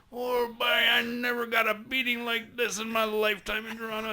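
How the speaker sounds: high, hammed-up Newfoundland twang; imitating the Newfoundland guys' accent, laughing; East Coast accent. Newfoundland guy tells the cops off, mock East Coast accent. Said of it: "pardon my East Coast accent".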